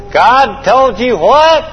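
high, drawn-out disbelieving question; mock incredulity. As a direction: stretch it out, rising in comic disbelief. Acting incredulous reaction at a hundred.

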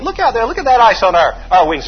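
high, loud alarmed call; mock alarm over icy wings. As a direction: point out the ice in alarm. Alarmed shout to copilot about ice.

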